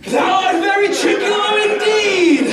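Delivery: high, loud, laughing recognition; laughing shout. Acted. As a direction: burst out laughing as you name him. Stefano finds Trinculo, laughing.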